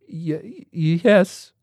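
nervous stammer, pitched high. Acted. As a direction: stumble on the first yes. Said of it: "Rawlings stammered".

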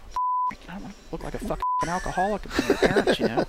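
mock outraged squeak. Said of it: "I'm like".

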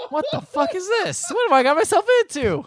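high, loud, laughing disbelief; incredulous outburst. Retold or imitated. Co-host reacts to the absurd movie scene, incredulous outburst. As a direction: cry out in hammed-up horror through laughter.

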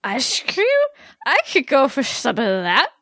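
put-on slurred drunken aside; hammered. Hammered Vex wants ice cream, slurred drunken voice. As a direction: slur it like you're hammered. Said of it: "Came the clearly hammered footnote from Vex".

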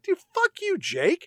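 shot up high, indignant; mock outrage. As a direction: snap back at Jake. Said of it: "And I'm like".

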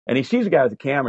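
normal talking voice

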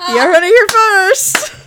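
high, loud through laughter; barely getting words out laughing. Real, not acted. Joke line amid group laughter.

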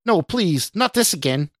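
high, desperate plea; pleading in dread; horrified cry. A narrator's reading. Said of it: "Scott shouted".

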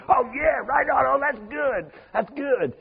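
high, eager voice of impressed students; impressed classmates. Retold or imitated. Preacher mimicking his college classmates, high eager approval. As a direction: gush like a naive student won over. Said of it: "my classmates saying".

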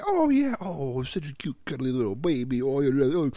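high, gushing coo over a newborn; baby cooing. Retold or imitated. Parent describing doting on a newborn, high gushing coo. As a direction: melt over a cute baby.

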